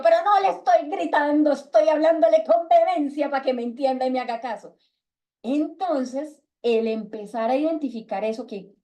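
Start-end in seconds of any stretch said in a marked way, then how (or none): 0.0-4.8 mock indignant protest. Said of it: "y yo".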